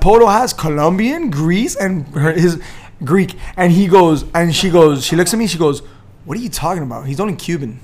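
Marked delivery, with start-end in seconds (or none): none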